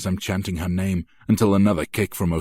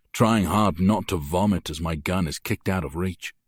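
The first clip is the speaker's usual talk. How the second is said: ordinary delivery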